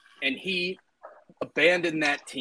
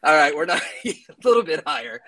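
laughing; 5 dB louder, faster than usual. Real, not acted. Laughing concession in banter.

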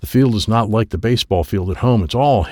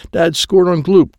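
ordinary delivery, nothing marked

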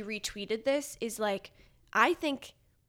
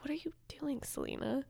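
breathy, hushed disbelief; incredulous whisper; 5 semitones higher, 9 dB quieter than usual. Retold or imitated. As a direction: whisper it like you can't believe her. Exasperated aside at Selena.

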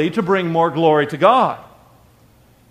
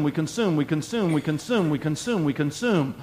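quiet refrain; 3 semitones lower, 7 dB quieter than usual. Acted. Repeated refrain, dropped voice.

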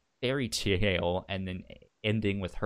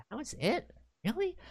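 very high, incredulous; mock disbelief; 5 dB quieter, faster than usual. [name] baffled at joining so easily, mock disbelief. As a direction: act stunned it was so easy.

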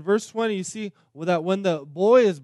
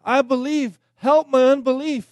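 high, loud desperate cry; 8 semitones higher, 10 dB louder than usual. Retold or imitated. Father crying out to Jesus for faith, loud desperate cry. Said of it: "the father child cried out and said".

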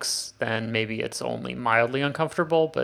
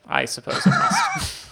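laughing. Real, not acted. Laughs at the fireball question.

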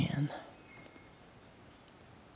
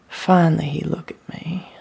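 usual speaking voice

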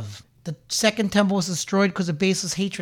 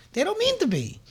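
"They don't mean to be" much higher and softer; high sympathetic echo; 12 semitones higher, 4 dB quieter than usual. Real, not acted. Preacher echoes his excuse for the phonies, much higher and softer.

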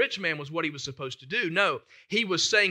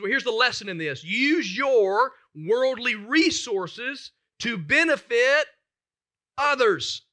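slow, weighty scripture reading, rising louder on benefit; reading Jesus' words; 5 semitones higher, 5 dB louder, slower than usual. Acted. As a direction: read it as Jesus speaking, slow and heavy. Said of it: "he says".

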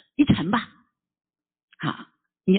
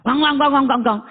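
loud knocking sound; 5 semitones higher, 3 dB louder than usual. Retold or imitated. Knocking a third time.

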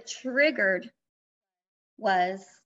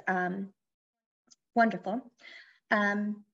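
ordinary talk, nothing marked

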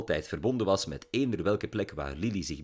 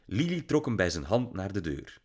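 ordinary delivery, nothing marked

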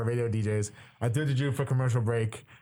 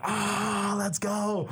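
mock crowd roar, higher-pitched; erupting crowd; 7 semitones higher, much slower than usual. Broadcaster recreating the crowd's eruption on the audio, mock cheer. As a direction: imitate a cheering crowd without full volume. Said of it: "hear the crowd just erupt".

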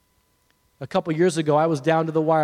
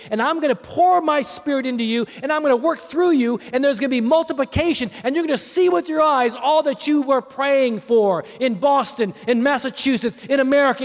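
high, driving preacher's delivery; impassioned preaching; 8 semitones higher, 4 dB louder than usual. Real, not acted. Preacher declaring God's promises over the congregation, impassioned preaching. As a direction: build promise upon promise with fervour.

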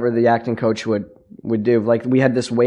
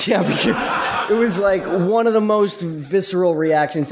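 real laugh breaking into the words, higher pitch; amused, high and laughing; 8 semitones higher, slower than usual. Real, not acted. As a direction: answer through laughter. Laughs at host's joke while answering.

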